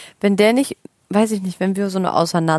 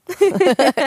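short loud chuckle; amused giggle; real laugh; 6 semitones higher, 4 dB louder than usual. Real, not acted. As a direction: burst out laughing. Laughs at her own phrase.

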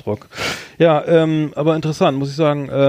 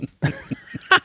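high giggle. Real, not acted. Won't say what grandpa was.

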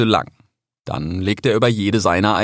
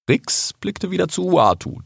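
usual speaking voice

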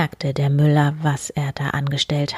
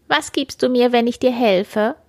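high, lightly voiced little man; a hint of a curious little man; little man's voice; 6 semitones higher than usual. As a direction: ask like a small odd mannikin. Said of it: "fragte es".

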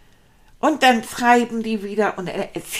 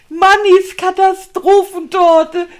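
higher, louder and slow, savouring it; proud announcement; 7 semitones higher, 9 dB louder than usual. Acted. Rat names his failed cake, proudly announcing. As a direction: present it like a grand title. Announces joke name grandly.

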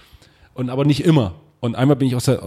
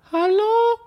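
timid voice through door. Retold or imitated. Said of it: "kam so von innen so".

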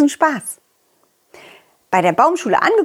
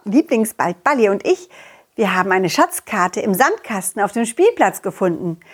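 plain reading voice

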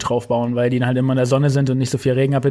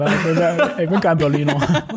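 ordinary delivery

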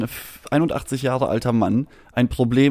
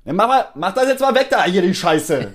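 much higher, louder whiny grouch yelling at Bodo; mock grumpy old neighbor; 8 semitones higher, 4 dB louder than usual. A grumpy old neighbor griping at Bodo about the fries, much higher and louder. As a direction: ham up a cranky pensioner ordering him to move the fries.

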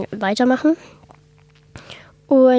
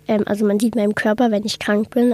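ordinary delivery

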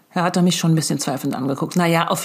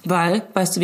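ordinary delivery

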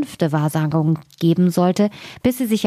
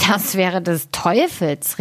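slow, drawn-out astonished cry; miller's exclamation; slower than usual. A narrator's reading. Miller astonished at the raven's prophecy, exclaiming slowly. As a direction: exclaim it slowly, without raising the volume. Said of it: "rief der Müller".

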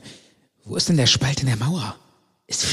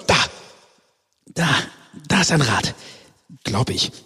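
usual speaking voice

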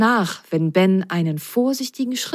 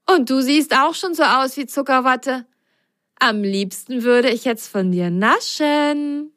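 grinning; 6 semitones higher than usual. A narrator's reading. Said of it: "grinste Ben".

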